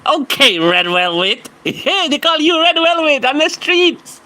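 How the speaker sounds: high laughing tease; mocking through laughter; laughing mockery. Real, not acted.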